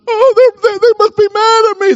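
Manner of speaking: high, loud mock whine; hammed-up distress. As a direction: wail it in exaggerated self-pity, very high. Acts out offended churchgoer overreacting.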